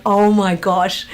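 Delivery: loud, drawn-out exclamation; mock amazement. Actress retells hearing they want passport details, mildly hammed-up amazement. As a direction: stretch out a retold reaction, loudly. Retold reaction to passport request.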